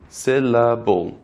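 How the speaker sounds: "Syllable" slowly in three even, drawn-out beats; rhythmic syllables. Shown as a demonstration. Teacher letting learners hear the rhythm within the word, slow syllable-by-syllable demo. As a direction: stretch each part of "Syllable" without stopping. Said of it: "It's a rhythm within the word".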